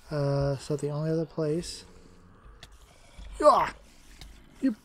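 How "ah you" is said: raging gamer. Real, not acted. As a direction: rage at the unfair hits. Gamer getting hit unexpectedly.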